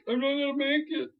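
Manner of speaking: high, strained hero cry; a lightly hammed-up desperate hero; mock panic. Hero dangling for his life, mock panic.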